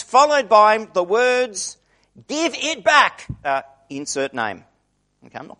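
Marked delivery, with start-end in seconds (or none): 2.3-3.2 a child's indignant demand, mock-voiced; imitating a squabbling child. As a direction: play the kid snatching back a toy, lightly. Sibling tussle over toy.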